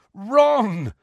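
high, strained croak of a name; croaked cry. A narrator's reading. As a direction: croak it out in alarm. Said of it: "croaked Harry".

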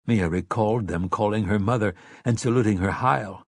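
ordinary delivery

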